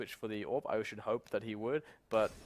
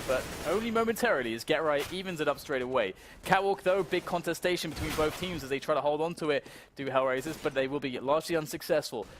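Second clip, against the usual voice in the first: esports caster; 6 semitones higher, 6 dB louder than usual. Real, not acted. Live play-by-play.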